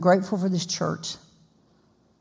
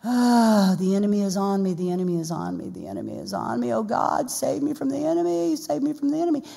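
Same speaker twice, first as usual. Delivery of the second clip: woe is me; 2 semitones higher than usual. Acted. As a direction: play the woeful sufferer, droning into a plea. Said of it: "a little bit woe is me".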